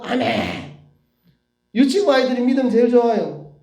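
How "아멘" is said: put-on emphatic childlike response in a changed voice; acted child's amen; kindergartner answering. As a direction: answer like an unquestioning little pupil.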